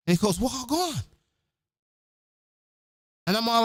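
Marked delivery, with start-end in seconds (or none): 0.4-1.0 high-pitched Jamaican patois reply. Retold or imitated. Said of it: "They're speaking patois back and forth".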